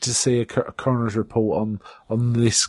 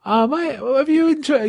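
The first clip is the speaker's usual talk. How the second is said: high mock voice; 13 semitones higher, slower than usual. Boss voiced in retelling.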